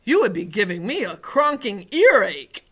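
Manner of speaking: loud, emphatic giant voice; warning giant. A narrator's reading. Giant warns the girl in his ear, loud and emphatic. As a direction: warn firmly as a big giant.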